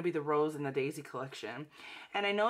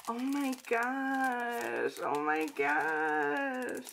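drawn-out, higher-pitched gushing; real delight; 4 semitones higher, much slower than usual. Real, not acted. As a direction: stretch each one out in awe. Delighted reaction to opened package.

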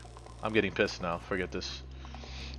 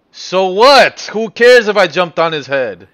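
exasperated; 5 semitones higher, 10 dB louder, slower than usual. Real, not acted. Exasperated rant at the game.

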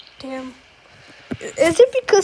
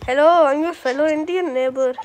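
put-on Indian accent; 2 semitones lower than usual. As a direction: play a friendly neighbour with an Indian accent. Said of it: "I'm gonna act as Indian".

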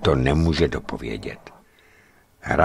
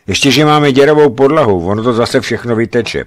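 pleased; 6 semitones higher, 8 dB louder than usual. Retold or imitated. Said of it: "pochvaluje si René".

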